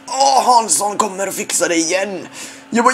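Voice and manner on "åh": usual speaking voice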